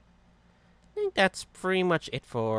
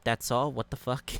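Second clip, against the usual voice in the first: ordinary talk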